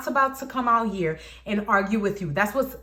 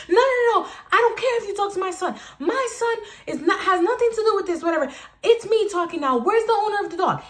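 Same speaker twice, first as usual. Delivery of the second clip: loud, much higher, fast yelling; angry lady; 11 semitones higher, 4 dB louder than usual. Retold or imitated. Neighbor lady yelling at Jose, loud high furious. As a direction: shout her down, shrill entitled fury.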